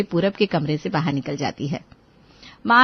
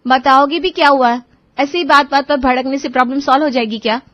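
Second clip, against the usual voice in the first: firm, slightly raised complaint; retorting wife; 4 semitones higher, 6 dB louder than usual. Acted. Wife tells her husband off about the tea, firm and hurt. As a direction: push back, calm but hurt.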